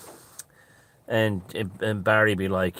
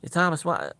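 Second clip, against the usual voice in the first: badly faked Southern accent, pitched up; attempted Southern voice; 8 semitones higher than usual. Junior hailing his workmate, half-hearted Southern accent.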